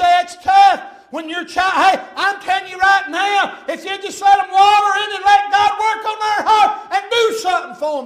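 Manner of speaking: loud, high, slowed shout; shouting preacher. Acted. Preacher shouting to parents at the climax, loud and high. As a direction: build to a roaring climax.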